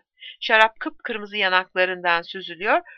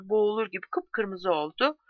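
usual speaking voice

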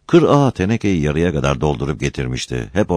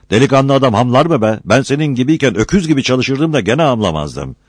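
higher, loud belittling rebuke; scornful scolding; 9 semitones higher, 5 dB louder than usual. A narrator's reading. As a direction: put the tired young man to shame. Uncle scolds tired nephew. (küçümseyerek, yüksek sesle azarlama)

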